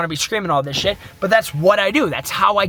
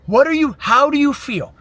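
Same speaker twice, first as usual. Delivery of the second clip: higher-pitched, mock yell at himself; retold yelling; 6 semitones higher than usual. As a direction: re-enact a freaked-out demand, voice pitched up. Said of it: "I'm yelling".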